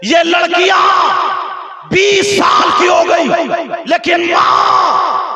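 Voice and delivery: high, loud, long-held cries; full-force preaching. Real, not acted. Preacher reproaching mothers of grown daughters, full-force chant. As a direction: wail the reproach out in ringing phrases.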